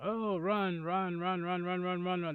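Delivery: panicked shouting, high and loud; being chased. Real, not acted. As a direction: yell run while fleeing. Fleeing enemy in game.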